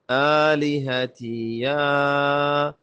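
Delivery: آلہتی یا with the final یا held long; madd on یا. Shown as a demonstration. Teacher shows learners where to lengthen, drawn-out madd on یا. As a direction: stretch the vowel of یا in one steady tone. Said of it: "یا پہ مت کریں".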